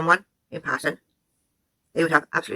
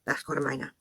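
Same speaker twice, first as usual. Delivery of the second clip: dropped low; 3 semitones lower, 10 dB quieter than usual. Real, not acted. Commentator rebuts, repeats point.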